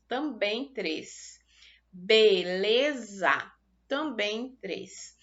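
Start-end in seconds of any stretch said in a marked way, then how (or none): 1.9-3.5 "Beleza" said very slowly and louder as be-le-za; split into syllables. Shown as a demonstration. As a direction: sound out "Beleza" in three clear beats. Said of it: "observem que eu fiz a pronúncia de três sílabas".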